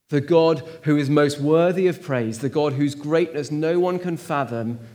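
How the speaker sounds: ordinary talk, nothing marked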